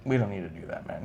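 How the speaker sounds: low, hushed, low-key refusal; Randy Orton. Retold or imitated. Fan imitating a wrestler declining a photo gimmick, quiet and easygoing. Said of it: "he said it like this too".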